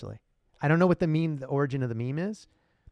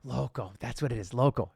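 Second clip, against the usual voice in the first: ordinary talk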